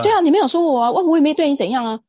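high, loud defensive yell; the groper shouting back in the night market; mock angry retort. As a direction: bark back at her, indignant. Groper's retort in retold quarrel.

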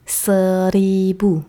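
seratus drawn out slowly, twice; slow teaching repetition. Shown as a demonstration. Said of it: "ribu是千".

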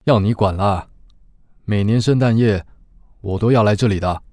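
loud, even radio-drama reading; story narration. Acted. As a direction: read the scene to the listeners. Narration and Xiaoba lines. (广播剧旁白语气)

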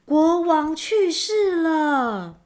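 high, loud, long-drawn proclamation; crying out news. A narrator's reading. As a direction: call the grave news out slowly across the street. News spreads through the streets. (拖长声音高声传报)

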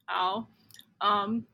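talking with a mouth full of food; speaking through a mouthful. Real, not acted. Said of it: "我现在嘴巴里有食物".

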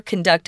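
"conduct" said con-DUCT, as a verb; stress on second syllable. Shown as a demonstration. Teacher giving an orchestra example, second-syllable stress on "conduct". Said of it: "which we usually use as a verb".

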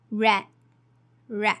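"re" with no h sound; silent h. Shown as a demonstration. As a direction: say "re" dropping the h. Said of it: "we don't have to pronounce the h sound".

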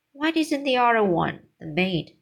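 ordinary delivery, nothing marked

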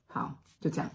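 hushed whispered brush-off. Retold or imitated. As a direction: whisper it to end the talk. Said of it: "她说".

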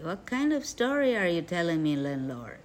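plain reading voice

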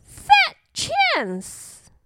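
very high, dismissive squawk; scoffing. A narrator's reading. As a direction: dismiss it like a cocky little bird. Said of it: "scoffed the bully bird".

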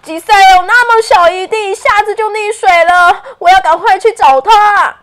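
loud, high, desperate calling out; yelling across the room. Acted. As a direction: call out a lost friend's name. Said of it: "喊道着".

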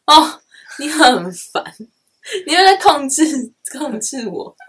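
loud, high playful complaint; mock annoyed. Real, not acted. (假装不耐烦地抱怨)

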